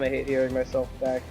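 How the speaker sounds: normal talking voice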